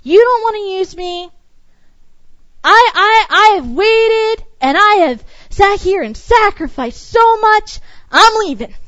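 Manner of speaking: mock huffy resignation; quitting in anger. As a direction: storm off offended. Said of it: "And we go".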